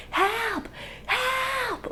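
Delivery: very high, held-back mock scream; long high-pitched cry, contained; imitated scream. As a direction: act out screaming for help without full volume. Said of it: "I start screaming".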